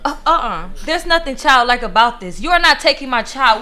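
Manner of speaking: loud, rising anger at the ex-husband; furious. Acted. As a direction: shout him down to protect her child. Mother refuses to give up child.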